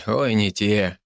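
usual speaking voice

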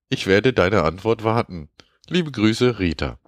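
high, sugary mock voice for a dating-scam mail; reading as scam woman. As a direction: read it as a coy stranger writing in broken German. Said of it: "Und zwar schreibt sie".

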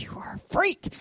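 whispered in disbelief; hushed, weirded-out accusation; mock-shocked whisper. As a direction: imitate a freaked-out friend under the breath. Classmates' reaction acted out.

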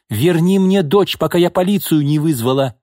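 high, heated threat; shouting angrily. A narrator's reading. Mother threatening to call police, shouting angrily. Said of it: "крикнула Бакшеева".

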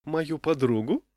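high, slow, coy question; coy rising lilt; coquettish saleswoman. A narrator's reading. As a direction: draw it out flirtatiously. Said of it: "кокетливо прищурилась продавщица".

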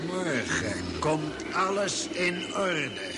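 slow, hypnotic repetition to put him to sleep; slow lulling. Acted. Lulls the confused man to sleep. (langzaam en sussend, als hypnose)

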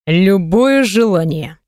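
low, slow and languid; sultry voice. Acted. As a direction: draw it out seductively. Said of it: "произносит томным голосом".

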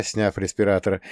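plain reading voice

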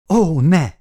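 sudden anguished cry, high and loud; husband sees wife dying, cries out in despair. A narrator's reading. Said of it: "esett kétségbe a férfi".

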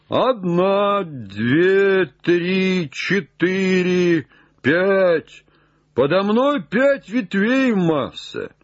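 high, stretched counting; calling out a count. Acted. Jupiter counts branches for his master, high calling voice. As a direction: call each number down from up high.

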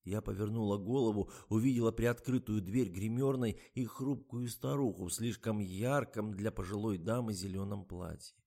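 ordinary delivery

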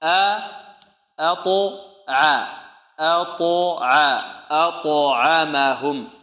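أطعمهم built slowly: light أ, heavy bouncing ط, light ع; syllable-by-syllable build-up. Shown as a demonstration. Teacher breaking down the word, أطعمهم in slow careful steps. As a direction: stretch أطعمهم piece by piece, only ط heavy. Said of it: "والطاء مفخمة".

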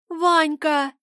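put-on a girl's high sobbing call, tearful; sobbing cry. A narrator's reading. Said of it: "всхлипнула Снежка".

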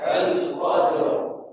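unvoiced whisper of القدر. Shown as a demonstration. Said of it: "انطقوا معي". (همس)

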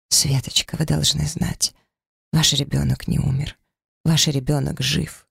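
ordinary delivery, nothing marked

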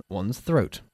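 clears throat mid-phrase; throat clearing demo. Shown as a demonstration. Teacher shows the word by clearing throat.

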